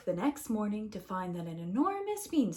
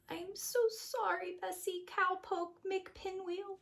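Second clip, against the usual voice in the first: mock sad farewell; 9 semitones higher, 8 dB quieter than usual. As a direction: say goodbye to your cow tearfully. Jack says goodbye to cow.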